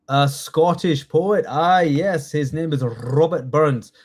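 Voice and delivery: slow, put-on Scottish accent; hammed-up Scottish with rolled r's. Shown as a demonstration. Teacher giving the quiz answer to the class, Scottish accent. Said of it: "best Scottish accent".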